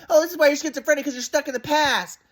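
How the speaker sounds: high-pitched hammed-up exasperation; mock mom scolding. Son mimicking mom shutting him down, high mock scolding. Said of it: "my mom's like".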